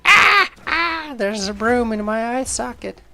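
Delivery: loud, very high hammed-up screams; mock-agonised high screaming. Victim with a broom in the eye, hammed-up screaming. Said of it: "while I'm screaming".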